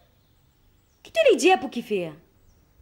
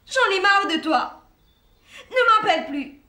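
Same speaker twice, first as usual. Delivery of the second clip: angry; 5 semitones higher than usual. Acted. Breakup quarrel on the phone.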